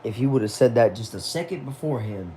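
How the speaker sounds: usual speaking voice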